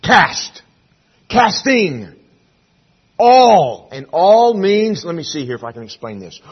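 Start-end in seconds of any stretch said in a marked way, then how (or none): 0.0-3.9 CAST, CASTING, ALL, each punched high and loud; emphatic single words. Shown as a demonstration. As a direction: hit "Cast", "Casting", "All" hard, each standing alone. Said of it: "It's emphatic in its emphasis".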